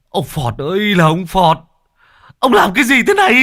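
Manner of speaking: high, loud anguished howl; howling wail. Acted. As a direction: wail it out, pitch climbing. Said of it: "rú lên". (rú lên thảm thiết)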